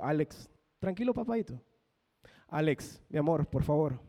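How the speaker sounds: put-on soft, coaxing a small child; gently calm the boy down; soothing dad. Father soothing his son. (papá calmando a su hijo con voz suave)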